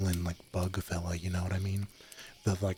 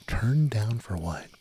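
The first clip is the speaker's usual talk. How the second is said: slow whispered joke line; ASMR whisper; 3 semitones higher, 5 dB louder, slower than usual. Acted. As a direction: whisper it slowly, teasing. Whispered ASMR stream chatter.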